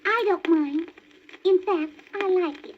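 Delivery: high bashful little skunk; shy skunk voice. A narrator's reading. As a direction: play the shy skunk sweetly. Skunk accepts the name.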